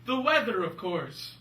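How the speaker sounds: loud, high burst settling down; mock certainty. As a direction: hit it as the obvious answer. Mocking officials' silly explanation.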